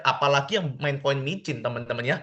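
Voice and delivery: usual speaking voice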